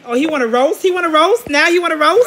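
loud, rising, incredulous questions; challenging callout. Real, not acted. Taunting a player who now wants to roast, loud challenge.

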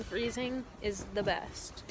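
normal talking voice

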